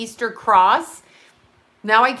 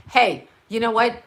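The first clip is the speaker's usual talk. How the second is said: usual speaking voice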